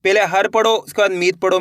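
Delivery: ordinary delivery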